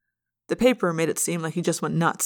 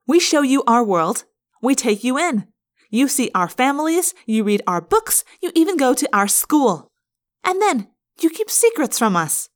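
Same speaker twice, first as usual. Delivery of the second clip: higher, building list of grievances; indignant reproach; 5 semitones higher, 4 dB louder than usual. A narrator's reading. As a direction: accuse him of betrayal. Rachel takes over the accusing.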